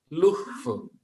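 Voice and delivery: luhuf said high, with an audible h in the middle; "luhuf" as luh-huf, a breathy second syllable; transliterated English love. Shown as a demonstration. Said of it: "ini transliterasi dalam bahasa Inggris".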